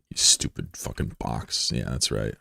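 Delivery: very low, quiet grumble; hushed, petty gloating; muttered insult. Pretend. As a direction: mutter it slowly under your breath. Insulting a box under his breath.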